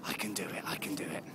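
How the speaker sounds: hushed, whispered I can do it; soft private pep talk under the breath; whispered self-encouragement. Retold or imitated. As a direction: whisper it to yourself to keep going. Self-talk on final stretch.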